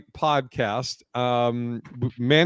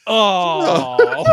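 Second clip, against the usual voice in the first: a long, dismayed crowd "oh"; higher, drawn-out mock crowd groan; audience groan; 6 semitones higher, 6 dB louder than usual. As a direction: groan like a whole disappointed room. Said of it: "you hear the whole audience just go".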